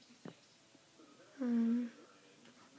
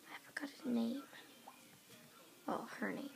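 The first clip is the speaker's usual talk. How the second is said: whispering; 9 dB quieter than usual. Real, not acted. Whispered aside.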